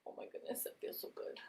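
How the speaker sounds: unvoiced pleased whisper. Real, not acted. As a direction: sigh it out in a whisper. Enjoying the face massage.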